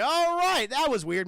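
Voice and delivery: loud, high drawn-out "all right"; acted relief. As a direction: brush it off loudly, hammed-up. Character relieved brain is gone.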